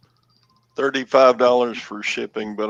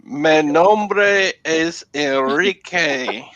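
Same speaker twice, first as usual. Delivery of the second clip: slow, high mock Spanish accent; a badly faked Spanish accent; 5 semitones higher, slower than usual. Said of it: "I can't do a Spanish accent very good".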